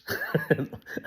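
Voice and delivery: quick light laugh; short laugh. Real, not acted. Preacher signing off, laughing.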